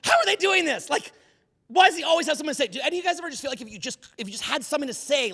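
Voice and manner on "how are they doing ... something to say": pitched way up, baffled and envious; mock his younger self, high and incredulous; exasperated disbelief. Recalling his younger self envying smooth talkers, high and exasperated. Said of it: "I'm like".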